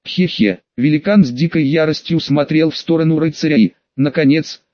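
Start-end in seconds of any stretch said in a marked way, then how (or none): 0.1-0.6 low, hoarse gloating chuckle. A narrator's reading. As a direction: chuckle darkly like a necromancer. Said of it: "Хриплый голос некроманта".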